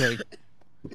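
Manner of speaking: coughing mid-sentence; words broken by a cough; cough over word. Real, not acted. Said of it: "you gotta stop coughing over there".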